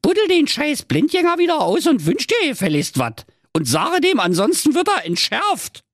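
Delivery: high, forceful scolding wife; threatening Berlin nag; bossy wife. Acted.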